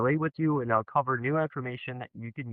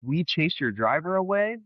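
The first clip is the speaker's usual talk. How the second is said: higher pitch, rising on "away" as a question; mirrored question; 5 semitones higher than usual. Acted. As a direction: echo it back, lifting "away" into a question. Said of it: "here's Chris Watts. ... I married".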